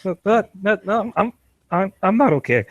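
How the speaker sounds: 높은 목소리로 당황한 항변; high panicked protest. Acted. Kumba panicking.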